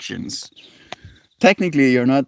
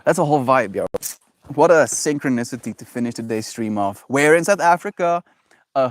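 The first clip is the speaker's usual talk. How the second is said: ordinary talk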